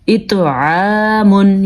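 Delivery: slow اتعامن with bounced heavy ط and throaty ع; qalqala, full ط, clear ع. Shown as a demonstration. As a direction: echo the ط, then long عا in اتعامن. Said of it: "ایک تو توا قلقلہ". (اتعامن، ط پر قلقلہ اور واضح عین)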